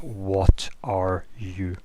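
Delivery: slow, halting translated words, each one spaced out; halting machine translation. Acted. Alice's comm translating the figure's question, slow and halting. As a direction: read it out slowly like a clumsy translator device. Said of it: "is what she's translating".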